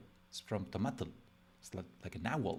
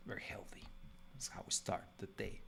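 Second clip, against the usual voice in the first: whispered sarcasm; hushed joking aside; ironic aside; 8 dB quieter than usual. Real, not acted. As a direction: slip it in as a dry whisper. Ironic aside about breakfast.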